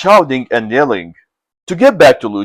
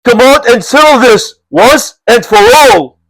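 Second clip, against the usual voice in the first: high, louder challenge; shouting; 10 semitones higher, 5 dB louder than usual. Acted. Brigand chief challenging Lu at the manor, shouting. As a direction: call out the enemy. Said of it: "shouted the brigand chief".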